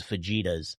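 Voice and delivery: fajitas said "fuh-JEE-duhz"; pronounced as spelt. Retold or imitated. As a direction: say fajitas naively with an English J. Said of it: "try to pronounce things like they look".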